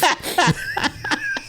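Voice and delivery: high-pitched burst of laughter. Real, not acted. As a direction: squeal with laughter. Joke about wet heaves.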